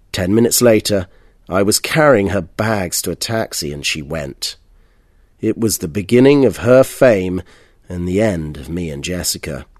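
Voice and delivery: usual speaking voice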